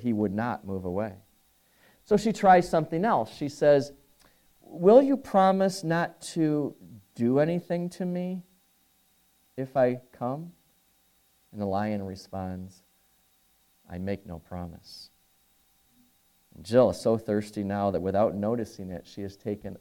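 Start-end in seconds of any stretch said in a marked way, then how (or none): 4.6-10.5 put-on a girl's voice lifted a little higher, nervously asking; timid pleading girl. Jill bargains with the lion. 13.9-15.1 low and quiet, a lion refusing gravely; low stern lion. Acted. Lion answers Jill.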